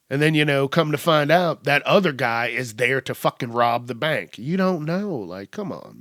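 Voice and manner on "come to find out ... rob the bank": ordinary delivery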